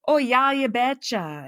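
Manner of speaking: "Oh, yeah, you betcha" with long drawn Minnesotan O's; a light Minnesotan put-on, long O's; Minnesotan accent. Shown as a demonstration. Host shows listeners what Minnesotans say, in a mild Minnesotan accent. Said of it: "you just say".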